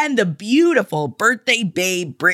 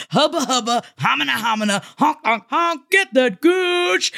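goofy honking nonsense, slowing at the end; silly clown; 3 semitones higher than usual. Acted. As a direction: ham it up like a clown.

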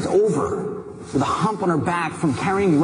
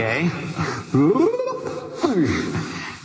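laughter. Real, not acted. Laughing over sake.

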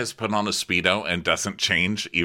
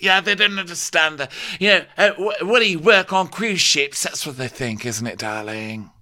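hammed-up fawning Camille; 8 semitones higher, 4 dB louder than usual. Mocking Camille again.

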